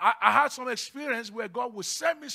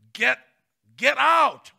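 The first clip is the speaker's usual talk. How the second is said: loud, high exorcist's command; commanding shout; 4 semitones higher, 9 dB louder, slower than usual. Shown as a demonstration. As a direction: shout it like casting out a devil. Demonstrates casting out devils.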